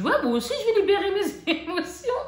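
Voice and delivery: high, laughing self-mockery. A mother retelling her purchase, laughing. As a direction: laugh at your own decision mid-sentence. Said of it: "Je dis".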